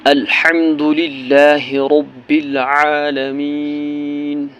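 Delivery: slow, drawn-out melodic recitation; measured tajweed recitation; slow Quran recitation. Shown as a demonstration. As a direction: chant each word slowly and reverently.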